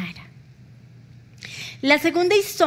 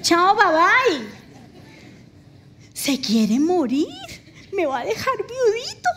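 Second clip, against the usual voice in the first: mocking husband; 6 semitones higher than usual. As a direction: play the husband gleefully imagining widowhood. Husband's imagined thought, mocked.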